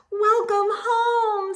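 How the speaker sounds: high, slow sing-song welcome; cheery mother. A narrator's reading. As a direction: welcome your girl home. Mother greeting lovingly.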